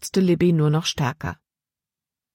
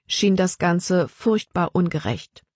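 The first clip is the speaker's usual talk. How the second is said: ordinary delivery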